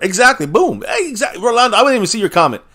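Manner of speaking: high-pitched burst of vindication; excited agreement. Real, not acted. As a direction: exclaim it high, thrilled someone thinks the same. Delighted a commenter made his point.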